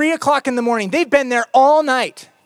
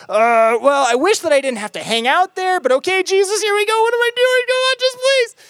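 higher, whiny voice pleading with Jesus; mock a grudging believer dragging his feet; half-hearted reluctant prayer, higher and pleading; reluctant follower; 10 semitones higher, 4 dB louder than usual. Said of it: "you're like".

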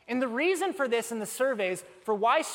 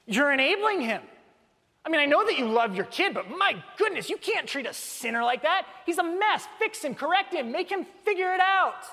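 mock outraged scolding, higher; indignant objection; 6 semitones higher, 3 dB louder than usual. Churchgoers scolding the forgiving father, mock outrage. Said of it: "we're like".

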